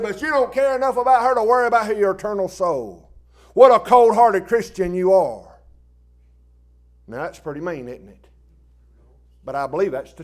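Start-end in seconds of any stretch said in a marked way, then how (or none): 0.0-5.6 loud scolding that slows at the end; loud rebuke. Retold or imitated. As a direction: hammer the accusation home. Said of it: "I said".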